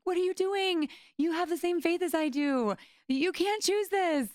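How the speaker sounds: high, loud frantic plea, slowing at the end; frantic pleading. Retold or imitated. To her friend with the same faith, high loud frantic plea. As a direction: burst out in alarm, begging her. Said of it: "I wanted to just jump out of my skin".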